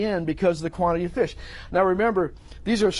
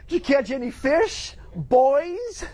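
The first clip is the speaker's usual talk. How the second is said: high, singsong teasing question; needling, raised-pitch hail; mocking call to buddies; 8 semitones higher, slower than usual. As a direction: taunt your pals from afar. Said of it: "That's not Jesus".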